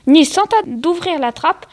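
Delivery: plain reading voice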